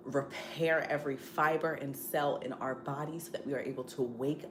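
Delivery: quiet, earnest mealtime grace; prayer. Real, not acted. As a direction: pray softly over the food. Prayer before the meal.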